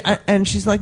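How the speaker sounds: ordinary delivery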